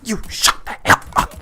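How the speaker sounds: rough, noisy scolding outburst; angry mom. Retold or imitated. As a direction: imitate a mom snapping at her kids. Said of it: "the mom goes like".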